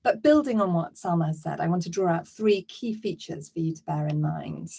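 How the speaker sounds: ordinary talk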